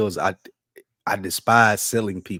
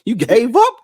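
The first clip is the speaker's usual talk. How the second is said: high-pitched mock disbelief, getting louder; incredulous teasing; 11 semitones higher, 6 dB louder than usual. Friend ribbing a buddy about his stomach, incredulous. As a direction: needle him with high, laughing incredulity.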